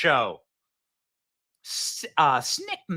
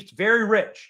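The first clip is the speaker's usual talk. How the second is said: ordinary talk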